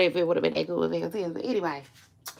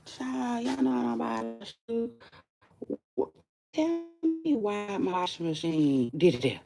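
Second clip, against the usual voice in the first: higher, retold outrage at the washer; mock outraged self; 6 semitones higher, 3 dB quieter than usual. Retelling her rant at the washing machine, mock outrage. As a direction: play her own fed-up rant. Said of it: "I'm like".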